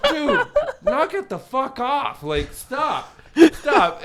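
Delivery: high, loud, slow scolding with a laugh; mock exasperated scolding. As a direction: tell him off like a fed-up friend. Friends scold Jeremy.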